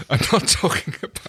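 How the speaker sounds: breaking up while reading; high-pitched, laughing attempt at the line. Real, not acted. Reader tries John's line but cracks up, laughing.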